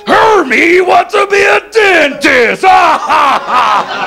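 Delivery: hammed-up taunting cartoon boss; jeering elf boss. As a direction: announce it to humiliate him, then force a big laugh. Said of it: "his boss cuts him down in front of all the other elves".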